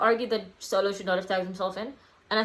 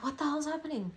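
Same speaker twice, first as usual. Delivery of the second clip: confused disbelief; 7 semitones higher, 7 dB quieter than usual. Retold or imitated. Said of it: "you're just like".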